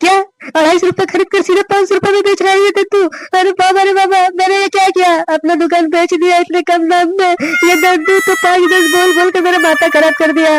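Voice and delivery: ऊँची आवाज़ में हाय हाय करता विलाप; माथा पकड़कर ऊँचे सुर में रोना-धोना; हाय हाय पछतावा. Acted. दुकान बेचकर पछताता फाका. (दुकान बेचने पर पछताता फाका, ऊँचे सुर में नाटकीय विलाप)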